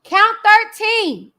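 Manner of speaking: slow, loud, high call; loud announcement. Real, not acted. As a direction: boom out the count. Dramatic announcement reading indictment.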